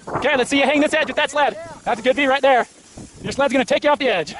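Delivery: normal talking voice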